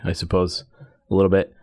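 ordinary talk, nothing marked

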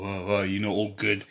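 ordinary talk, nothing marked

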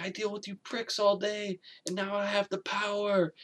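high put-on voice, relishing power; gloating receptionist. Receptionist getting back at the cops, high mock gloating. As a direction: imitate a petty clerk savouring revenge.

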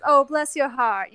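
slow Southern drawl, R's softened; Southern woman's accent. Retold or imitated. Southern woman being passive aggressive, slow Southern accent. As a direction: imitate a Southern lady, drawing it out. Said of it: "if Southern woman says".

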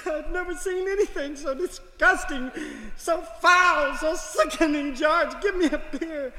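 put-on high, appalled older woman's voice; disgusted wife. Margaret appalled by hippies on the beach, high and disgusted. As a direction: shriek your disgust at the scene.

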